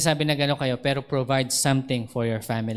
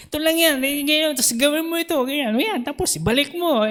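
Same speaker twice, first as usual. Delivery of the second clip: much higher, quick impatient instructing; impatient brother; 11 semitones higher, faster than usual. Retold or imitated. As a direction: rattle it off like a fed-up big brother. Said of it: "walang pasensya yung magturo". (kuyang walang pasensyang nagtuturo)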